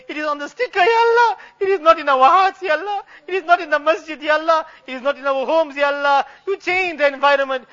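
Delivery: ordinary talk, nothing marked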